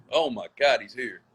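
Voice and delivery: usual speaking voice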